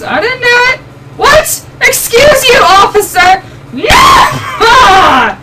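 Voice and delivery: high, loud protest; indignant shout. Real, not acted. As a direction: protest loudly to the cop. Arguing with officer in game.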